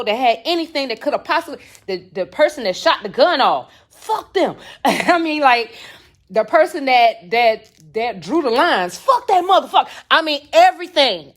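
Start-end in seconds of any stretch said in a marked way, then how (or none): 3.9-4.6 higher-pitched mock cursing burst; retold angry cursing. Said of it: "I was cursing at everybody". 9.0-9.9 loud, higher-pitched angry retort; mad cursing. Retold or imitated. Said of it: "I was mad".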